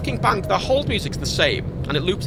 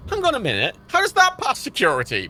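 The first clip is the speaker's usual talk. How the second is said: tag; 6 semitones higher than usual. Retold or imitated. Retelling an argument with bank.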